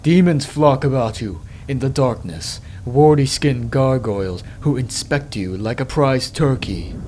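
ordinary delivery

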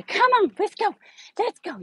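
hyped-up encouragement. Shown as a demonstration. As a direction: hype the dog up to follow you. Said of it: "if I say no and then say".

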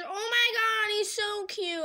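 high-pitched fangirl gush. Real, not acted. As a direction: gush over something adorable. Gushing over cute drawings.

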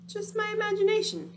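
nervous rabbit thought. Acted. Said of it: "he thought".

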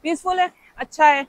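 ordinary talk, nothing marked

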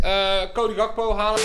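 normal talking voice

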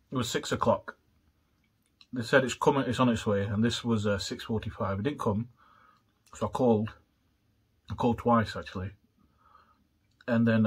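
talking through fingers; slightly muffled after bite; hand over mouth. Real, not acted. Seen 1: Fingers rest over lips from 2.7 to 16.7 after eating.

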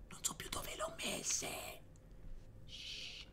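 breathy whisper to himself while searching. Real, not acted. Streamer searching his notes, whispering to himself. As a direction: drop to a whisper, thinking aloud.

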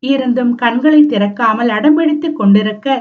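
ordinary delivery

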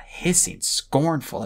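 plain reading voice

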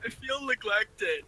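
slow, high mock whine; whiny. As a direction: drag it out like a sulking kid. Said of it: "drawn-out repeat".

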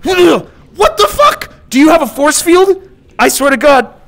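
slow, high, loud disbelief; stunned outburst. Real, not acted. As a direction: shout in disbelief. Attack bounces off, stunned.

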